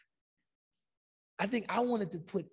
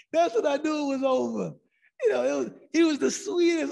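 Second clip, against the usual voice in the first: laughing; 7 semitones higher, 9 dB louder than usual. Real, not acted. Laughing while retelling bombed speech.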